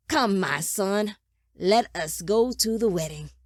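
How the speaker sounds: loud, stately invitation; grand summons. A narrator's reading. Father summoning son after the kill, grand satisfied command.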